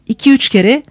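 plain reading voice

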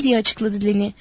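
ordinary delivery, nothing marked

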